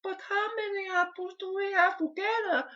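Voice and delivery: put-on very high, slow Mexican child voice; a child's high Mexican accent, puzzling over apples; young Mexican kid accent. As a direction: squeak it out like a small Mexican child asking for help.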